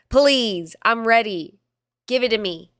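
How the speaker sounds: loud, eager mock pleading; hammed-up eager plea; eager begging. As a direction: beg for it like you can't wait.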